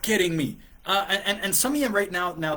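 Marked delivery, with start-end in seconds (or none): 0.0-0.6 raised, slowed mock outburst; mock outrage. Coach voicing what he wants to say to her, mock outrage. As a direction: act out the angry thought, played up. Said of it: "part of me wants to go".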